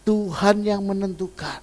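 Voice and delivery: high, loud pleading prayer; retold prayer. Mother retelling her prayer for her daughter, loud high pleading. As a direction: cry out to God to choose for her. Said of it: "Saya bilang".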